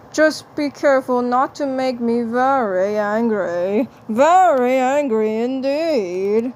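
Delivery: put-on Martian voice, mock menace, slowing at the end; a cartoon Martian issuing a polite threat; Marvin the Martian. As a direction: Marvin warns Peepers not to make him angry, in a mock-menacing Martian voice. Voices Marvin warning Peepers.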